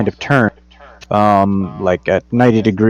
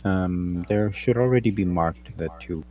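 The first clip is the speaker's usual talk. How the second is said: quiet, far from mic; 3 semitones lower, 10 dB quieter than usual. Real, not acted. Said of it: "[name], why do you sound so quiet?".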